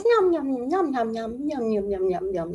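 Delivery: high, odd cat voice for yum; the pet cat greeting John in a high creature voice; cat's greeting. Retold or imitated. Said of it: "she's like".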